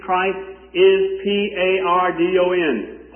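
preacher spelling out "P A R D O N" letter by letter; spelled out. Shown as a demonstration.

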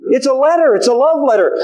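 ordinary talk

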